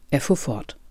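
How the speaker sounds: ordinary delivery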